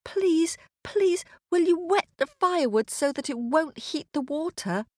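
high, urgent plea to the maid; pleading little girl. Acted. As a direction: beg for help in a high voice. Girl begs the maid for help.

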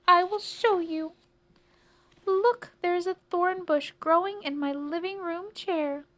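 high, loud, fretful voice; distressed old lady. Acted. Old lady shows the policeman her chair, distressed. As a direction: present the disaster in a high voice.

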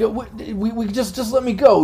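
high, stammering captive's plea; pleading prisoner. Acted. Game master as intimidated prisoner asking for release, pleading. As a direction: beg your captors, lightly acted. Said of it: "he seems a bit intimidated by that".

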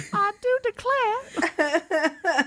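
very high, slow British dame accent, hammed up; mock British dame. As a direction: drawl it out like a grand British lady. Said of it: "like, a British dame".

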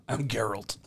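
a lightly growled Batman parody; hint of a Batman rasp; mock Batman voice. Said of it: "He's just doing Batman".

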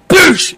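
loud explosive imitated nail shot; nail gun noise. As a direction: burst it out like a tool firing. Imitating nail gun firing again.

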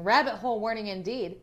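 ordinary talk

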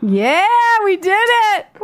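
high, slow triumphant cheer; excited cheer. Real, not acted. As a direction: celebrate loudly, stretched out. Celebrates end of meditation.

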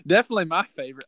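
laughing. Real, not acted. Joking about own podcast.

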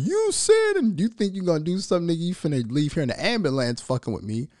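high-pitched mock challenge, easing down; mock confrontation. Man acting out a run-up from some challenger, mock confrontational. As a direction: challenge him in a put-on high voice.

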